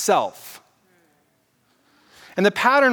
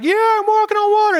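jumps way up in pitch, giddy and boastful; show-off Peter crowing about walking on water; cocky Peter; 13 semitones higher, 4 dB louder than usual. Retold or imitated. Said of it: "Peter's like".

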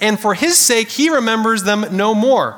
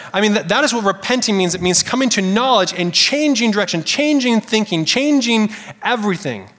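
ordinary delivery

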